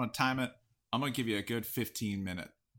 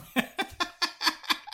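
wheezing laugh. Real, not acted. Laughs at pun.

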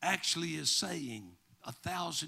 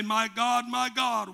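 louder, higher preacher's retelling of a cry; loud cry quoted; 6 semitones higher, 7 dB louder than usual. Retold or imitated. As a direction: call it out as a quoted plea. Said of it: "Jesus cried with a loud voice".

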